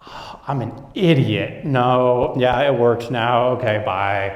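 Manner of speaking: lightly voiced caller feeling foolish; embarrassed customer. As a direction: customer on the phone, sheepish and embarrassed. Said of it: "the customer's on the phone".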